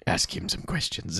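slow, soft whisper; retold whispered prompt; whispered aside. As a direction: urge him on under the breath. Tony urging quietly.

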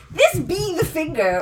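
slow, high mock of the teacher's "This be the finger"; imitating the teacher saying "This be the finger"; improper English. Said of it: "she would use improper English".

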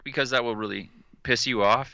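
normal talking voice

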